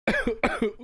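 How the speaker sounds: sputtering cough after choking; coughing up water. Real, not acted. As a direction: cough out the water that went down wrong. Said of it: "water went down the wrong tube".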